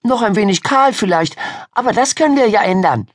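put-on high, eager girl's chatter; excited storyteller girl. Miriam telling Ida about the storm, excited. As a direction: relish the dramatic news in a high voice.

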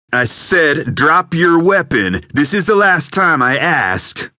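high, loud, slow threat in a strange voice; last warning before he fires; furious warning. Acted. Gunman's final warning.

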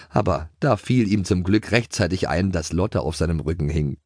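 ordinary delivery, nothing marked

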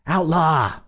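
high-pitched call through the door. A narrator's reading. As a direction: call his name, pitched well up. Shouting through a bedroom door.

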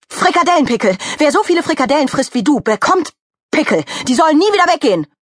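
high, heated tirade; angry cursing. Acted. Mickey Kuchen wishing pimples on the glutton, furious. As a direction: pile up spiteful wishes angrily.

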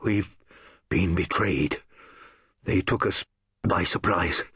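lightly voiced dying man, halting; dying gasps. Dying soldier gasping a warning to Clelia, halting. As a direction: force the words out between breaths. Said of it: "He tried to speak".

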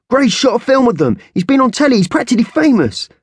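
put-on high boy's voice bragging about his friend; boastful boy. Boy bragging to Isis about Gray, high and eager. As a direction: show off for your mate. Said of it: "snapped the boy".

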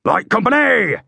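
high, loud parade-ground bellow; bellowed order. A narrator's reading. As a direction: bellow to the whole company. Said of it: "Hakeswill bellowed".

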